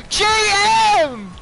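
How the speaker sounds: loud high call; shout. Real, not acted. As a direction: yell his name. Calling out to friend.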